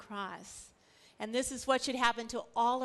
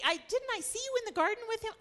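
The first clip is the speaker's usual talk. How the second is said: higher, fast, prying question; a higher pitched servant's accusation; suspicious servant; 10 semitones higher, faster than usual. Acted. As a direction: pin him down, quick and suspicious. Slave of the high priest accuses.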